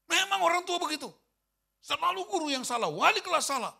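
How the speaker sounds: loud, high exasperated outburst; angry outburst. Retold or imitated. Angry teacher ranting about parents blaming teachers, loud and high. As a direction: vent at parents who always blame teachers.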